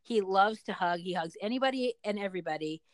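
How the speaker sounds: ordinary talk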